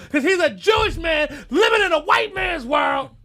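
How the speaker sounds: high, loud, fired-up yell; impassioned holler; ranting shout. Real, not acted. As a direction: shout it out as the rant peaks. Angry rant peaks again.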